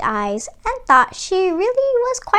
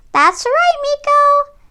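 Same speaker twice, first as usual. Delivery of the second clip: very high mouse voice; pleased little creature; 14 semitones higher, 3 dB louder than usual. A narrator's reading. Mouse agreeing with Miko, high and pleased.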